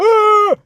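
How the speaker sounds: sudden loud high shriek; startled scream. Retold or imitated. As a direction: shriek as if something jumped at you. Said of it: "screams in my face".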